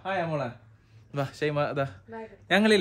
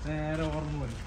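hushed; 6 dB quieter than usual. Real, not acted. Baby asleep in car.

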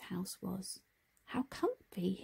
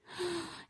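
gasp; 9 semitones higher, 7 dB quieter than usual. Acted. Harry sees broken scooter.

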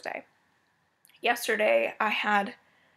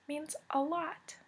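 soft, halting, higher voice, holding back tears; close to tears; 6 semitones higher, 9 dB quieter, slower than usual. Real, not acted. Vlogger thanking viewers for their support, close to tears. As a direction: say it slowly and quietly, about to tear up. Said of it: "I'm not gonna get teary-eyed".

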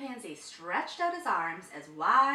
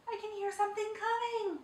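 1 whispered; 12 semitones higher, faster than usual. A narrator's reading. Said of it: "whispered the chimpanzee".

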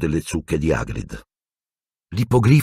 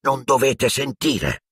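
slow, strained rasp, a bit higher; hoarse voice; 7 semitones higher, slower than usual. A narrator's reading. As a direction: croak the warning. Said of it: "disse con voce roca".

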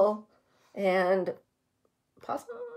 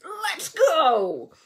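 shouting; 5 semitones higher than usual. A narrator's reading. Repeats the badger's shout.

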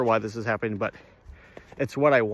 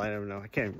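ordinary delivery

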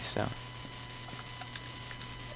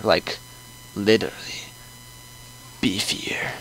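low, hushed, nearly whispering; whispered; 3 semitones lower, 10 dB quieter than usual. Acted. Guest hints at wanting beef, hushed whisper.

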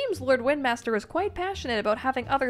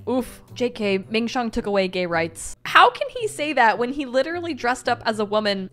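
usual speaking voice

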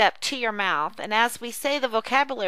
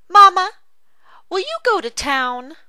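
put-on child voice; 9 semitones higher, 7 dB louder than usual. Child role in read dialogue.

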